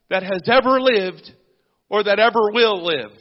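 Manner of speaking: loud, rising preacher's call and response; full-force preaching. Acted. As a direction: build it up, shouting the cries of sin and blood.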